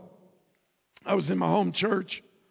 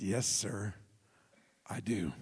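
very soft, low, restrained reply; on good behavior; 16 dB quieter, slower than usual. Retold or imitated. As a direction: answer politely, holding everything back. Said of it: "I was on good behavior that day".

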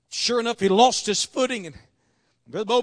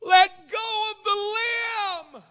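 high, very drawn-out heavenly call; voice from above; 9 semitones higher, much slower than usual. Acted. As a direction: intone it slowly as a voice from on high. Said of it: "And the voice says".

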